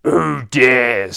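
loud, menacing villain voice; big booming bogey challenge; Boogeyman. Acted. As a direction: challenge them like a monster lord. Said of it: "says the Boogeyman".

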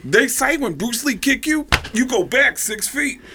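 high, emphatic comic rant; high celebrity mimicry, insistent; Tracy Morgan impression. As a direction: preach odd wisdom in a high put-on voice. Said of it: "And he goes".

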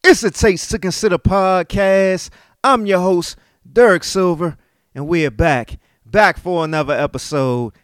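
loud, high radio-style show opener; hype host intro. Acted. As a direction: announce the show like a DJ. Podcast intro again, host announcing.

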